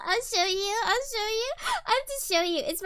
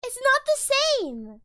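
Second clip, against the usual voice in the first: high, drawn-out complaint; whining protest; 7 semitones higher, slower than usual. Real, not acted. Can't get his inflections, whining protest. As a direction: whine it slowly, pitched up.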